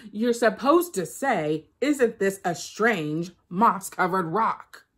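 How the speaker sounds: slow, loud, every word laid out; slow, loud, deliberate spelling-out; spelled-out insistence. Acted. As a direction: drill the phrase into her. Anansi spells out the phrase.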